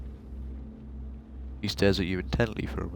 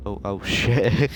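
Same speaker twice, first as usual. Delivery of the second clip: laughing; 2 semitones higher, 4 dB louder, much slower than usual. Real, not acted. Laughs at own slip.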